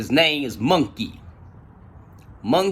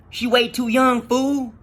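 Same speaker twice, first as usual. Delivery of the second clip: high, insistent talking-to; retold reality check, pitched well up; exasperated scolding; 10 semitones higher, slower than usual. Speaker tells Monkey she won't wait, exasperated.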